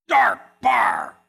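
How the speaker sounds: low, loud shouted Dark bar; dramatic shout. Acted. Pastor describing the bar to his congregation, dramatic shout. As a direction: boom it out to paint the gloom.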